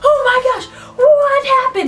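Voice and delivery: retold panic, high-pitched and breaking; panicked cry. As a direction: shriek it in alarm, half laughing. Panic at friend screaming.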